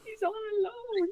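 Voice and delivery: high, slow, drawn-out lament; hammed-up heartbroken squeal; mock pitying wail. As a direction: wail it in exaggerated pity. Pitying wail for lonely pet.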